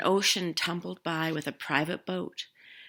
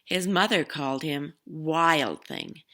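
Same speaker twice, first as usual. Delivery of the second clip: ordinary delivery, nothing marked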